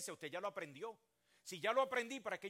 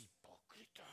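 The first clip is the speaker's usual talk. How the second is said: muttered hipócrita; 9 semitones lower, 19 dB quieter, slower than usual. Pretend. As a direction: whisper "Hipócrita" with a rising tune, voiceless and low. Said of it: "lo mira a usted y dice".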